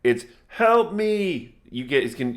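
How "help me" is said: high, loud scream for help; terrified woman screaming. Acted. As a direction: shriek it like a woman in mortal terror. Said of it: "blood-curdling scream of a, a woman".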